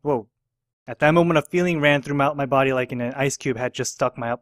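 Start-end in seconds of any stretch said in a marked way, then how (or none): none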